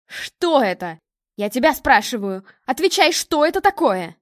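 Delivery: loud, rising interrogation; furious demand. A narrator's reading. Mother confronting daughter over the dog, furious. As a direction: demand an answer with mounting force.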